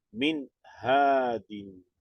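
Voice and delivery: "Min hadin" drawn out slowly and the nun is not nasalized; slow, careful tajweed reading of "Min hadin"; Arabic recitation demo. Shown as a demonstration. Teacher reading the example to students, slow and careful. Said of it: "Entonces acentuamos nada más".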